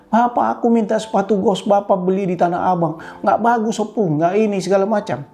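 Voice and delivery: put-on high-pitched child's grievance; complaining child. Child telling on father to grandparent, high-pitched complaining.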